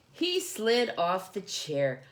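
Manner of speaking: ordinary delivery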